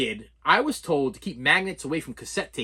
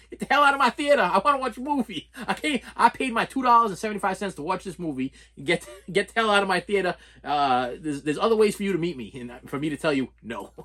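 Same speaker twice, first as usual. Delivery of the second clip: mock angry; 2 semitones higher than usual. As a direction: throw her out of my theater, mock furious. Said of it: "Matter of fact".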